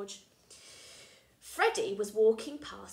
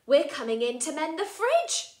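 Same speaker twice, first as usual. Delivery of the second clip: higher, louder, breezy announcement; cheerful twins; 6 semitones higher, 10 dB louder than usual. Acted. Charlene and Marlene telling George they're coming in, bright and loud. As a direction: sing it out brightly.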